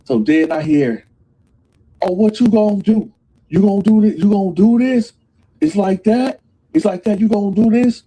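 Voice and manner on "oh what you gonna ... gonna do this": loud, high, taunting challenge; confrontational challenge. Retold or imitated. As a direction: square up and dare him to start it. P Nice confronting Bone in cell.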